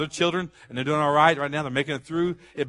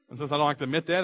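usual speaking voice

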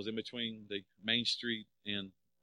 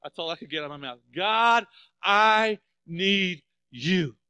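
loud, high, slow anguished plea; desperate cry to God; 9 semitones higher, 12 dB louder, slower than usual. Retold or imitated. Man alone in his car crying out to God, loud anguished plea. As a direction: cry out to God, stretched and breaking. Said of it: "I looked at God and said".

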